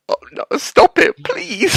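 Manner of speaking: high pleading squeal; pleading cry. Retold or imitated. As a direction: beg them to stop, high and loud. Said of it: "I was like".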